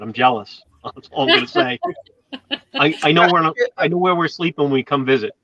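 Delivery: usual speaking voice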